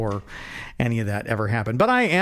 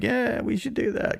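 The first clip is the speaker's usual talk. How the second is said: fake agreement; 9 semitones higher, 4 dB quieter than usual. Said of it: "And I'm like".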